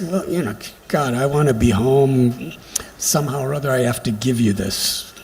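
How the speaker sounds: low, listless mumble of a worker who'd rather be home; mock weary reluctance, trailing down; reluctant, grudging. Airline hostess wishing she were home, grudgingly serving a passenger. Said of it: "They're not supposed to just".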